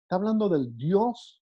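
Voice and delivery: ordinary talk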